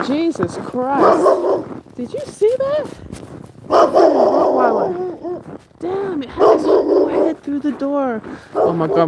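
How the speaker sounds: real fright, voice jumps up high; shaken, just got scared by a dog lunging; startled gasp. Real, not acted.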